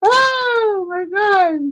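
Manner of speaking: high, drawn-out shriek of alarm; a real startled cry, very high and slow; startled squeal. Real, not acted. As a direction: squeal it out long and high, half laughing. Reacting to loud animal noises.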